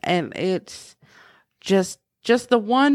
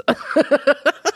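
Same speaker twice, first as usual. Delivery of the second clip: high laugh; 8 semitones higher than usual. Real, not acted. Mom laughing about her son finishing the lyric.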